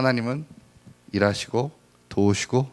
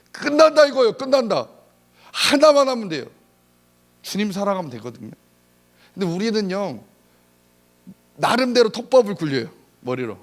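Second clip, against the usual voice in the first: loud, high fervent sermon; full-throated preacher calling out to the pews; preaching at full force; 10 semitones higher, 6 dB louder than usual. Acted. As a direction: drive it home to the congregation.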